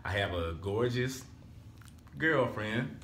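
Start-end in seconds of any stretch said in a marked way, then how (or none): none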